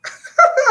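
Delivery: squealing laugh burst; high cackling laugh. Real, not acted. Laughs at own joke.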